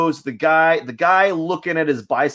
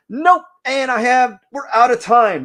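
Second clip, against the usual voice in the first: mock alarm; 9 semitones higher, 6 dB louder than usual. Fake timer alarm, live stream ending.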